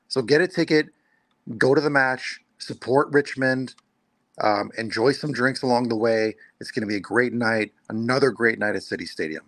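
ordinary delivery

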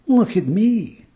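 high, loud, drawn-out cat voice; echoing cat. A narrator's reading. Cat echoes the fox.

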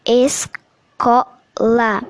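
ESCOLA very slowly, split es - co - la; "Escola" segmented slowly for a syllable-division demo. Shown as a demonstration. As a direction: break "Escola" into separate syllables, pausing between each. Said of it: "uma sílaba lentamente, naturalmente, a segmentamos".

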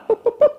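high boop boop boop; mimicked keyboard beeps. Pastor mimics the clerk typing, high beeps. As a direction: beep like a keyboard.

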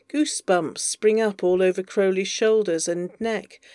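ordinary delivery, nothing marked

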